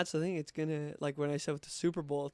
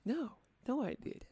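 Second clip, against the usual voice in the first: higher, mock secret whisper; 9 semitones higher, 4 dB quieter than usual.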